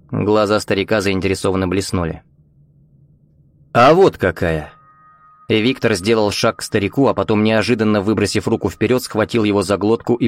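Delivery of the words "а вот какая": menacing. Acted. Victor about to grab teacher.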